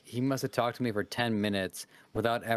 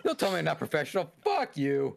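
mock angry retort; 6 semitones higher than usual. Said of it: "it's like".

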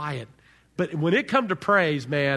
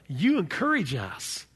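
normal talking voice

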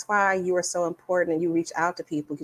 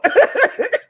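high loud laugh; 8 dB louder than usual. Real, not acted. Guest laughing at her city council joke, loud high cackle.

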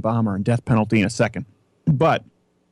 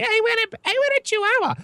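put-on high, pleading little-kid whine; whiny kid voice. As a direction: beg like a small child. Kids begging for a Chihuahua.

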